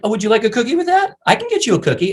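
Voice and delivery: high, put-on sweet service voice; gushing server. Chick-fil-A worker offering a customer a cookie, gushing. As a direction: offer it in an eager mock falsetto.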